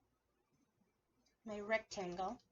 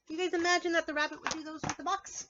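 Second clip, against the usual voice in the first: ordinary talk, nothing marked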